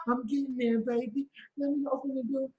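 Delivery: soft, submissive reply; meek appeasing man. Retold or imitated. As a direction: imitate a henpecked partner hurrying to obey. Meek man answers bossy partner.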